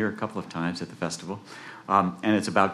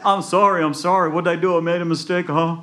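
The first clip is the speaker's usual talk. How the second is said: high, hurried-sounding pleading; frantic apology; 8 semitones higher, 5 dB louder than usual. Acted. Jack begging the farmer hitting him, high frantic apology. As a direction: plead high while dodging blows.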